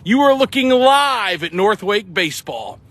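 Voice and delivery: slow, lifted TV announcer opener; Brent Musburger. Retold or imitated. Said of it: "in true Brent Musburger fashion".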